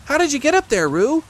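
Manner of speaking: high, loud little-animal voice; Piglet asking. Acted. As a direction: call the question up in a high pipe. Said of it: "asked Piglet".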